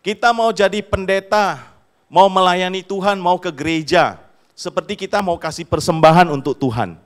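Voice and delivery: loud, high-pitched fiery sermon line; imitating a fervent student preacher; preaching student. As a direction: proclaim it like a zealous young preacher. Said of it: "dia bilang begini".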